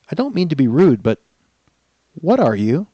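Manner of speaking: put-on eager young princess asking; cartoon princess. Princess character in story.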